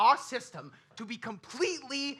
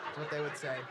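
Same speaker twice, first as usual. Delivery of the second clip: low, soft repeat of a tag line; hushed, low afterthought; subdued aside; 8 semitones lower, 9 dB quieter, faster than usual. Acted. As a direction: drop it quietly under the laughter.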